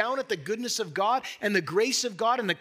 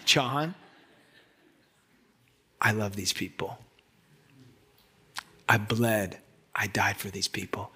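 voice of God; 11 semitones lower than usual. Retold or imitated. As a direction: speak as a patient, loving God. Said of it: "the Holy Spirit just spoke to my heart".

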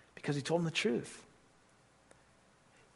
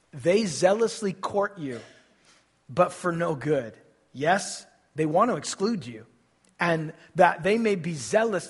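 scripture read out; 6 dB louder than usual. Acted. Reading scripture loudly.

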